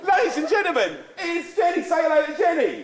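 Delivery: high, projected compère's introduction; mock grand announcement. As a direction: announce to the whole house to embarrass her. Announces Jenny to embarrass her.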